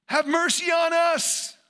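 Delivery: sudden loud, high, slow shout; crying out. Acted. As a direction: holler it slowly at full voice. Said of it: "all of a sudden they hear that Jesus has come by".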